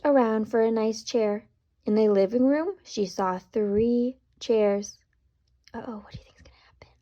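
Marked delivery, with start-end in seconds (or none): 5.7-6.9 fast hushed whisper; quick secretive whisper; suspenseful whisper. Acted. Suspense aside to child listeners.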